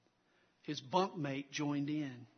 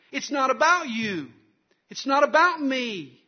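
loud, high, insistent pulpit voice; full-force preaching; 8 semitones higher, 13 dB louder than usual. Real, not acted. Preacher telling the congregation life is not about them, full-force preaching. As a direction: hammer each repeated denial home, raised and forceful.

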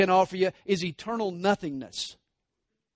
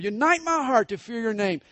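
verse prayed higher and louder; fervent prayer; 7 semitones higher, 5 dB louder than usual. Acted. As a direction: lift the verse up as a plea.

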